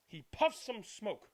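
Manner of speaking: ordinary delivery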